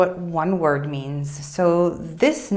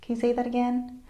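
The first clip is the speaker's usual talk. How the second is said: higher, slightly softer puzzled request; asking to repeat; 4 semitones higher, 5 dB quieter than usual. Acted. Teacher translating the Italian query for learners, higher questioning tone. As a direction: politely query what was just said. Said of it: "it's like".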